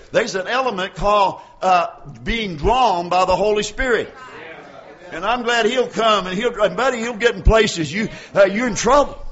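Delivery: loud, punchy preaching at full voice; fervent preaching. Acted. Preacher warning the church about conviction, loud preaching. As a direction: hammer each phrase at the congregation. Preaching on Holy Spirit drawing.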